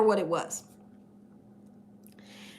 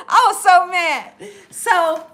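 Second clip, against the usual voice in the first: laughing; 4 semitones higher, 5 dB louder, slower than usual. Real, not acted. Laughing while telling.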